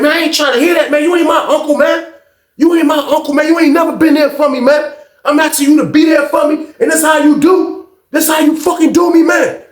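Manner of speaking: high-pitched, hurt and angry outburst; retold family argument. Nephew accuses uncle of never being there, angry and wounded. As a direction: act out a nephew's heated accusation, voice pushed way up. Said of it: "this is a whole family member argument".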